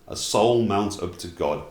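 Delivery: ordinary delivery, nothing marked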